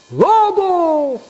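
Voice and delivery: very high, drawn-out mock cry; grito de alarma. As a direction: sound the false alarm as the boy. Boy cries wolf in story. (grito muy agudo de falsa alarma)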